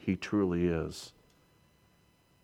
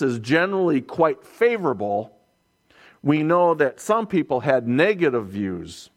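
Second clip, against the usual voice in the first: raised, louder preaching voice; preaching at full force; 8 semitones higher, 5 dB louder than usual. Acted. Preacher to his congregation, rising with fervour. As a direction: drive the contrast home from the pulpit.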